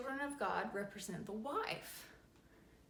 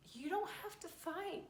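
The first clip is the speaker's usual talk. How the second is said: ordinary talk, nothing marked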